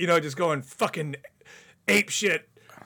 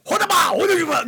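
high, loud burst of mock yelling; imitated yelling; 8 dB louder, faster than usual. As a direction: imitate a friend shouting angrily in Chinese. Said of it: "Just yelling and shit".

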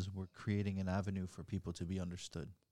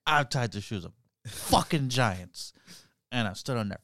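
put-on drunk voice; 5 semitones higher, 10 dB louder than usual. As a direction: bellow it like a drunk at the bar. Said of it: "That's my drunk voice".

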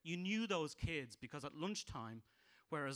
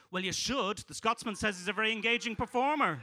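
loud, higher punchline delivery; daughter's sales pitch; 7 semitones higher, 10 dB louder than usual. Retold or imitated. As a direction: suddenly belt out the review. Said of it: "she'd go".

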